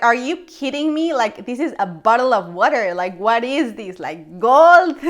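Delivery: outraged disbelief. Retold or imitated. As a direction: rant at the price, building to gold. Ranting at water price.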